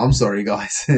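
plain reading voice

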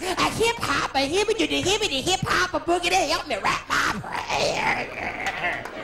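hammed-up Broadway diva; Carol Channing voice. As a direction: coo it like a dotty stage star. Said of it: "He must have been like".

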